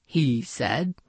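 ordinary delivery, nothing marked

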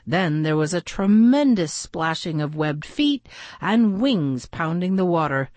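plain reading voice

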